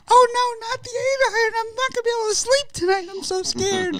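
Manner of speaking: very high falsetto mock panic; loud, high-pitched sarcastic fright; mock terror. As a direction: squeal it in hammed-up fear. Mocking fear of the eight iron.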